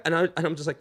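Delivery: ordinary talk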